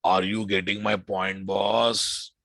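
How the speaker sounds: ordinary delivery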